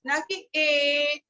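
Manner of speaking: ए stretched slowly as a long vowel; wrong long vowel. Shown as a demonstration. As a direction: show the long sound they must avoid. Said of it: "इस तरह से नहीं निकालेंगे". (ए को लंबा खींचकर, गलत उदाहरण की तरह)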